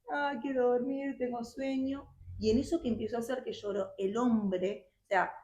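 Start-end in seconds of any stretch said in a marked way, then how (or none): 0.1-2.1 slow, whiny mock sob of a child pretending to cry; fake crying. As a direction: whimper it like a girl faking tears. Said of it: "empiezo a hacer como que lloraba". (llanto fingido, lento y quejoso)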